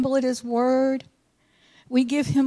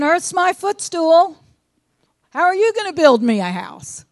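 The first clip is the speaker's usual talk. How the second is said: a hint of an incredulous God, a bit louder; lightly voiced God, mock disbelief; voicing God; 5 semitones higher, 5 dB louder than usual. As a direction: challenge the listener as God would, half-amused. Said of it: "So what he's saying is".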